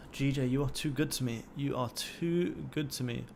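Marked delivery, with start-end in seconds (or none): 1.5-3.2 slower and softer, drawn out lovingly; savouring. Real, not acted. Streamer cooing to his winning trade, slow soft savouring. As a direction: linger on each word like a fond thank-you.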